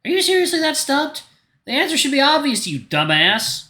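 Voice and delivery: high-pitched scornful jab; exasperated scolding. Acted. Fed up with someone missing the obvious, exasperated. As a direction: scold them high, then drop for the insult. Insulting someone slow to understand.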